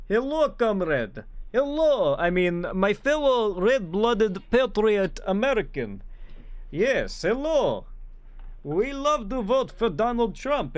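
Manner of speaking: mock Russian accent, high and loud and slow, hammed up; bad Russian accent. Streamer mocking a supposed Russian asset, in a hammed-up Russian accent. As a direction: greet her as a comrade in a badly faked Russian accent. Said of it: "I can't do a Russian accent".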